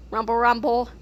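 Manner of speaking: ordinary delivery, nothing marked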